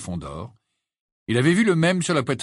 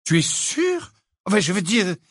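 very high question, then awkward backtrack; flustered disbelief; 8 semitones higher than usual. A narrator's reading. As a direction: ask in disbelief, then stumble. Hermione doubts, then backtracks awkwardly.

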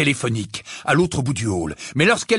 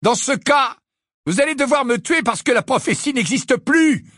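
roar; 9 semitones higher than usual. A narrator's reading. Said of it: "rugit Harry".

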